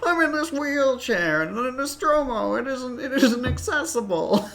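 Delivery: high-pitched celebrity impression; Jimmy Stewart. Player joking about a wheelchair in Nostromo, Jimmy Stewart voice. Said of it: "That's my Jimmy Stewart".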